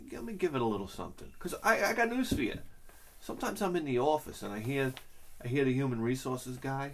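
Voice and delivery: usual speaking voice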